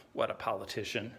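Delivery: low, soft, disgusted aside; muttered disdain. Acted. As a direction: drop it low and quiet, with contempt. Muttered disgust after the rival's dodge.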